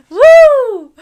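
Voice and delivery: loud, high cheer of pure excitement; excited whoop. Real, not acted. Podcast host cheering to her listeners, loud excited whoop. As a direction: let out a big happy whoop.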